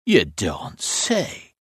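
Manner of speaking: slow, drawn-out, understated amazement; astonished exclamation. A narrator's reading. Roadworker hears startling gossip from his mate, mildly astonished. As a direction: let the news sink in, stretching each word. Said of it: "he exclaimed".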